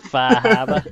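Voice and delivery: put-on high, laughing Boston accent; a laughing Boston drawl. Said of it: "with a Boston accent".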